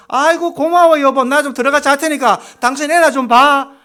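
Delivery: hammed-up wife crying out her thanks; grateful wife. Preacher acting a wife thanking husband, loud and delighted. Wife voiced in sermon example.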